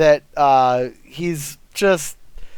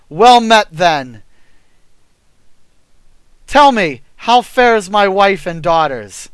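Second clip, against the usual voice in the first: king voice; 3 semitones higher, 6 dB louder than usual. Acted.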